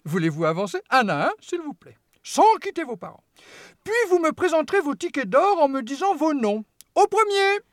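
high, loud, eager welcome of a showman; Wonka exclaiming. Acted. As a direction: cry out a grand welcome at the gates. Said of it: "Puis il s'écria".

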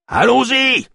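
high-pitched rallying cry; roared command. A narrator's reading. Rodag urging his companions after the stampeding horses, roared. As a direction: roar it out high and drawn tight. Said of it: "rugit Rodag".

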